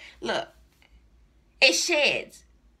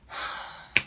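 long, soft exhale of frustration; fed-up sigh; 6 dB quieter than usual. Real, not acted. Wig buyer staring at her wasted purchase, fed-up sigh. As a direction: let out a real, weary sigh.

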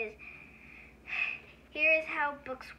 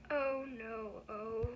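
mock dread; 4 semitones lower, slower than usual. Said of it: "make silly sounds like".

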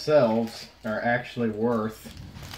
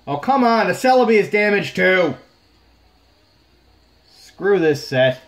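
much higher, loud furious yell; furious outburst; 8 semitones higher, 9 dB louder than usual. Real, not acted. Collector yelling as a second card comes out damaged, furious. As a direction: explode at another damaged card.

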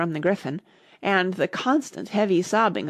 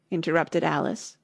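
ordinary delivery, nothing marked

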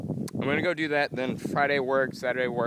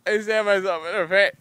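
louder hammed-up yelp; loud higher mock wail; mock anguish; 5 semitones higher, 7 dB louder than usual. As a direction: play the self-stab. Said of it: "I'm like".

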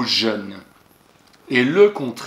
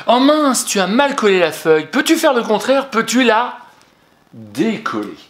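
put-on high, loud theatrical "oh no" to the child; mock dismay; 9 semitones higher, 6 dB louder than usual. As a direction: play up the dismay over a badly glued sheet, much higher and louder. Teacher acts out mistake scene.

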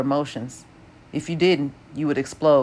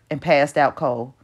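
ordinary delivery

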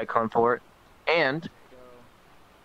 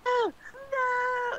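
very high, squeaky no no no; mock panicked squeal; much slower than usual. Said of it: "so it's like".

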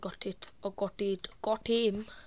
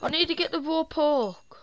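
higher, louder urgent complaint; frustrated loud; 5 semitones higher, 5 dB louder than usual. Real, not acted. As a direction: push it out in frustration. Frustrated, wants raw pork.

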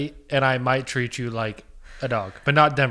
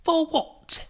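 very high-pitched mock British accent; Harry Potter. Shop assistant questioning a customer, high fake British accent. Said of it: "talk like Harry Potter".

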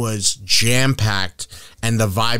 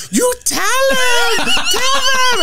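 very high, drawn-out squealed cheer; laughing older Mexican mom; 3 dB louder than usual. Retold or imitated. Older lady cheers on the host, high laughing squeal. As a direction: imitate a delighted mom egging someone on. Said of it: "she started laughing. She's like".

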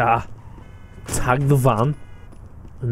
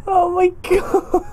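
high, slow, tearful laugh-talk; real laughing sob; crying with laughter; 14 semitones higher than usual. Real, not acted. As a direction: wheeze it out through tears of laughter. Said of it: "I can't even breathe".

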